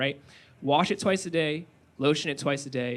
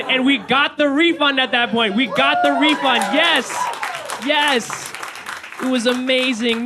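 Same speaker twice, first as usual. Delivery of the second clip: higher, louder victory cry; triumphant; 8 semitones higher, 5 dB louder, slower than usual. Real, not acted. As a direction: celebrate the win out loud. Triumphant refund celebration.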